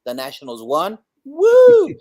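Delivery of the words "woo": loud, high whoop of joy; real cheer for the win. Real, not acted. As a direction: let out a big victory whoop.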